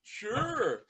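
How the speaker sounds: high, loud goofy sure. Retold or imitated. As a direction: drawl it like Goofy. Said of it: "Almost sound like Goofy".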